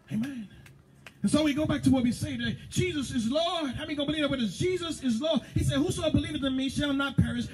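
high, fervent preacher's cry; preaching. Acted. Preacher rousing the congregation, fervent preaching. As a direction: preach it out full force to the church.

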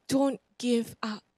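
ordinary delivery

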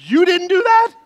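hammed-up shocked echo. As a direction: repeat it in stunned alarm. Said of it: "I'm like".

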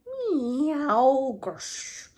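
yowl, then hiss like a cat at night; cat meow and hiss. A narrator's reading. Simpkin answering the sleeping tailor with meow and hiss. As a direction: meow then a hissing grsh. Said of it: "strange horrid noises as cats do at night".

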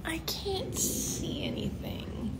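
slow, soft whisper; drawn-out whispered aside; whispered complaint. Real, not acted. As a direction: whisper it, stretched out slowly. Aside while looking at screen.

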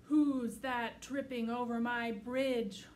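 loud slow booming troll; roaring troll. Acted. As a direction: roar it out like a troll. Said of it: "roared the troll".

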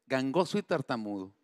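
ordinary delivery

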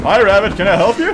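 high, loud, put-upon hello; mock greeting. Player confronting the rabbit in the game, mock polite. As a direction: challenge the rabbit with exaggerated politeness.

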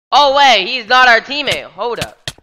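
high loud panic; panicked yelling. Real, not acted. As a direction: yell in sudden alarm. Panicked realization in game.

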